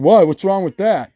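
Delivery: lightly voiced tweaker indignation; mock tweaker defensiveness. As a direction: act puzzled and offended. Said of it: "They'll be like".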